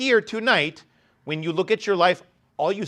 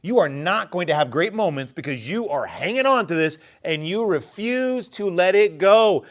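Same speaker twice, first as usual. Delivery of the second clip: normal talking voice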